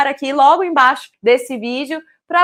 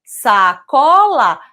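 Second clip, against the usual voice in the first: "Sacola" stretched very slowly, "sa" set apart; syllable by syllable; 5 dB louder, much slower than usual. Shown as a demonstration. Teacher adding a syllable to cola, "Sacola" syllable by syllable. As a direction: say "Sacola" slow and loud, in separate pieces. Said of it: "se lá no início eu colocar o sa".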